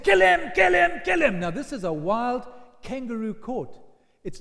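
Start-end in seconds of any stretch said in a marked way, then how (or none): 0.0-1.4 loud, high chant of a furious crowd; mob shouting. Acted. Crowd yelling at the high priest's court, loud chant. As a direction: yell it like a baying mob. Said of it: "And they all said".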